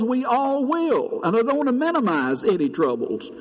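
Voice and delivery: preacher counting his blessings to the congregation, fervent; impassioned preaching. Acted. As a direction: lift the congregation with a rising testimony.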